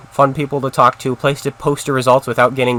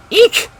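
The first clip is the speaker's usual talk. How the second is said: a sudden very high, loud squeak of fright; startled eek; 7 dB louder than usual. Real, not acted. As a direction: yelp "Eek!" like you just got shot at. Startled under fire in game.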